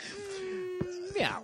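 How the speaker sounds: high squeaky meow; cat voice. Retold or imitated. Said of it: "He was like".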